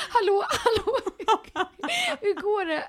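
very high phone hello through laughter; laughing retelling. Retold or imitated. Friend calling down the phone for an answer, laughing imitation. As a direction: imitate a caller while helplessly giggling.

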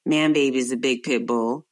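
ordinary talk, nothing marked

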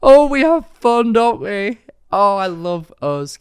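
high, loud, giddy laughter; laughing. Real, not acted. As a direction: giggle through every word. Helpless laughter, higher and loud.

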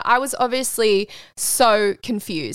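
ordinary delivery

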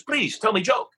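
put-on high Japanese-accented sushi chef; broken English accent. Sushi chef asks the laughing guest, high Japanese accent. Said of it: "speaks broken English".

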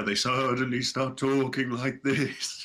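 slow, drawn-out mock ghost voice; put-on spooky ghost talk. Shown as a demonstration. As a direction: start talking like a spooky dead person, slowly. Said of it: "But in a spooky voice".

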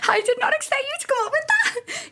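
high, amazed exclamation; startled by the host's research; surprised. Real, not acted. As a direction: laugh it out, genuinely caught off guard. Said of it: "you have done your research".

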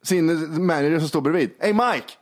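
a hint of a dazed [name]; mock [name]. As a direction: play a clueless pop star asking. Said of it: "Han säger så här".

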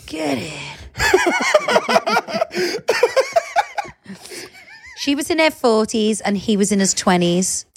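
normal talking voice

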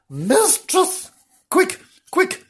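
high, urgent cry for help; alarmed call. Acted. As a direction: summon her in acted panic. Frank calls mistress for help.

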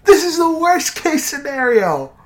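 high, loud, hammed-up crying wail; mock anguished wail. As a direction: wail like it's a tragedy.

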